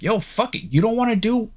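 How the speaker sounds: imagined star player's fed-up challenge, pitched high; pretend ultimatum. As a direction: push the voice way up like you're calling their bluff. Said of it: "'cause you can be like".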